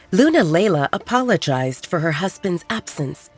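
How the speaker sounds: plain reading voice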